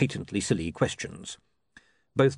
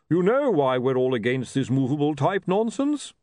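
higher, indignant character voice; blustering chief priest; 6 semitones higher, 4 dB louder than usual. Acted. Hunon protesting to Lord Vetinari about movable type, blustering. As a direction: protest with pompous force.

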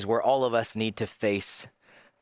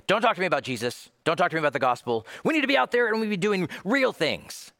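fast, higher-pitched protest; impatient dismissal; 8 semitones higher, much faster than usual. Acted. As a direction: wave the topic away, rushing and rising in pitch. Voices a listener brushing off Jesus.

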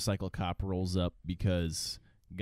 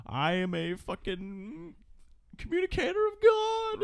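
slow, much higher, ominous drawl; creepy old man voice; slower than usual. Retold or imitated. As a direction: sound like a crazed prophet. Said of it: "And he's like".

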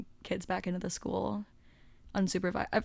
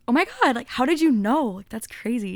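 loud, higher, amazed; hammed-up oh my God; mock amazement; 3 semitones higher, 7 dB louder than usual. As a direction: gush like it's a wild coincidence. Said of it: "And I was like".

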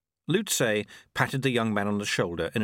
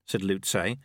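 plain reading voice

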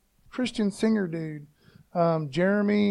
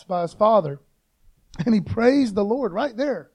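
normal talking voice

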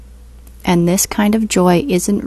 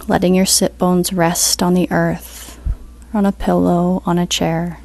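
guided meditation; 4 dB quieter than usual. Acted. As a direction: ease them into stillness, unhurried.

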